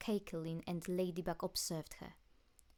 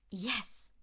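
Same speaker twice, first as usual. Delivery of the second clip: almost yelling; 3 semitones higher, 3 dB louder than usual. A narrator's reading. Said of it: "Adrien all but yelled".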